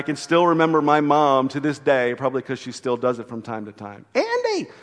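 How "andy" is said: high, carrying call across the water; a mother's frantic call over the waves; mom calling out. Retold or imitated. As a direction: call his name out over the storm. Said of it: "she'd be crying out his name".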